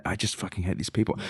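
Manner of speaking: very quiet, low grumble under the breath; exasperated aside. Real, not acted. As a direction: let it slip out softly, fed up. Exasperated aside about the defendants.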